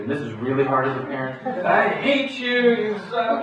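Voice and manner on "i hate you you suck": much higher and louder, slow mock tantrum; mock teen yell. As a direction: imitate a teenager yelling at a parent. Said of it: "while they're yelling at you".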